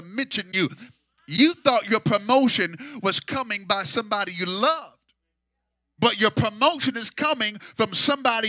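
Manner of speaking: loud, sung preaching cadence over the organ; loud tuneful preacher's whoop; preaching at full force. Acted. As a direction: whoop it out to the congregation.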